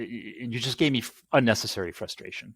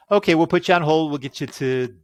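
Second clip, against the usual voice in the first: a chirpy, higher and louder phone-rep voice, lightly mocked; customer service rep voice; 7 semitones higher, 9 dB louder than usual. Mocking the phone agent to his listeners, in a perky higher voice. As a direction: brightly imitate the cheerful agent putting you on hold. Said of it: "so this is customer service".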